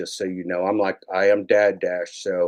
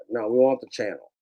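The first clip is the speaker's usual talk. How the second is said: ordinary talk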